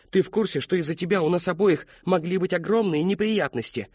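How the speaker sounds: lightly voiced scolding girl. A narrator's reading. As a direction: press him like a bossy friend. Mary Catherine scolds.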